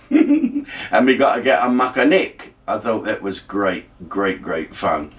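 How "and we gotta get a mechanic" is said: imitated West Indian accent; Georgie Campbell. Presenter imitating his mate about the car, West Indian accent. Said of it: "I had a mate called Georgie Campbell who said that".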